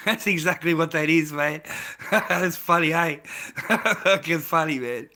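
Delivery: real laughter breaking through the words; laughing. Real, not acted. Man cracking up at the medicinal container joke, laughing.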